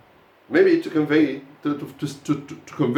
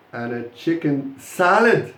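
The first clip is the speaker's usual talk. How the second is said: slow build, salad drawn out and punched; stress on salad; slower than usual. Shown as a demonstration. Teacher models the last order for the class, slowly stressing salad. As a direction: take it slowly and hit salad. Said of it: "So you stress the salad".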